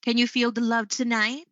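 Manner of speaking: "tonight" lifted at the end as a question; yes-no question, voice climbing on "tonight"; rising question intonation. Shown as a demonstration. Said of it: "example of closed-ended questions would".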